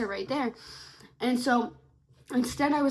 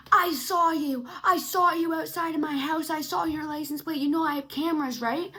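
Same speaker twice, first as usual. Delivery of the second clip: accusing stranger; 4 semitones higher, 3 dB louder than usual. Retold or imitated.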